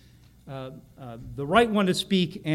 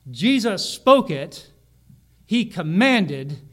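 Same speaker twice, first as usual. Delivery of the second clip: slow and louder, each word weighted; slow emphatic; 6 dB louder, much slower than usual. Acted. Preacher stressing to the congregation that Jesus commanded, slow and weighty. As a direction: lean hard on every word.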